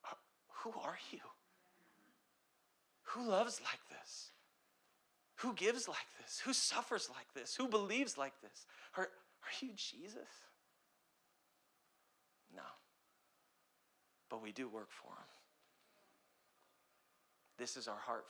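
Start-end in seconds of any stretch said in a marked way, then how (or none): 0.0-10.3 awed, wondering questions, higher and slowed; imitating stunned strangers marvelling; amazed onlookers. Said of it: "people get around us and they go". 12.5-15.3 quiet reply. Acted. Quiet answer after rhetorical questions.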